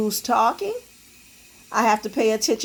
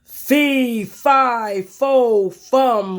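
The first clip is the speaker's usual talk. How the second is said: louder roaring giant chant; 2 semitones higher, 5 dB louder, much slower than usual. Acted. Giant chasing Jack, roaring. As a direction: roar each word. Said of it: "roared the giant".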